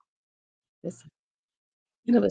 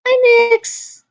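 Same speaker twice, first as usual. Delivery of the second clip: loud, high drawn-out greeting; calling out hello; 13 semitones higher, 10 dB louder than usual. Real, not acted. Greeting Nix out loud, calling out hello. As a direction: sing out a big high hello.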